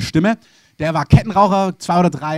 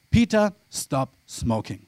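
slow, solemn, lower divine command; voice of God; 3 semitones lower, slower than usual. Pretend. God telling chain-smoking pastor to quit, solemn and slow. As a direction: speak as God giving a slow, weighty order. God's voice quoted again.

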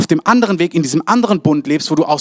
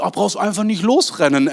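mild mock reassurance; 5 semitones higher than usual. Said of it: "so Sprüche".

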